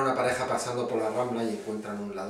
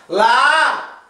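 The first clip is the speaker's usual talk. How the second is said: very high, very loud held yell; shouted answer; 18 dB louder than usual. Acted. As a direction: yell the eye-chart letter at the doctor. Paciente repite la letra gritando.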